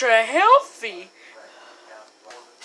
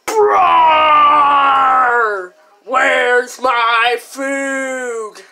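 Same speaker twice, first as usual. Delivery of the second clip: loud crying-out "Bro!"; shout; 6 dB louder, much slower than usual. Acted. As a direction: yell across the room for your buddy. Calling out for friend.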